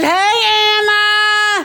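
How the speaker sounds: high excited call; excited greeting. Retold or imitated. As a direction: call out a bright hello at the door. Greeting her at the door.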